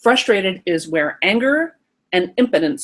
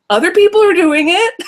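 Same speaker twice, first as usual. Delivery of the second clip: put-on much higher, louder childish argument; insistent kid; 10 semitones higher, 7 dB louder than usual. Inner child arguing with the parent, high and loud. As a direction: argue like a kid pointing at others. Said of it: "Marcy says".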